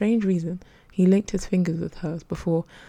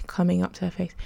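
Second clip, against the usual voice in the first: plain reading voice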